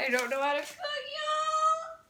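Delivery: high-pitched, wailing whine; comic tearful squeal; mock crying. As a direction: wail it like a hammed-up meltdown. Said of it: "I'm gonna cry".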